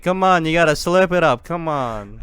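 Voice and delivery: high, loud hammed-up Italian accent. Urging Tony to slurp thrown noodles, mock Italian accent. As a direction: egg him on in mock Italian. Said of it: "I don't have a good fucking Italian".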